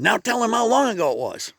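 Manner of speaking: high, lightly voiced old woman; old lady prompting. Other old lady telling her friend to reveal the date, high and prodding.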